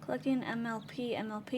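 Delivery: normal talking voice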